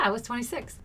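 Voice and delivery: ordinary talk, nothing marked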